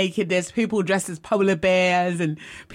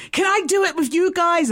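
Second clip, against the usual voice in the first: high, eager acted plea; pleading request; 10 semitones higher, 3 dB louder than usual. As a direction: beg to join in, voice pitched up. Acts out her plea to the guys.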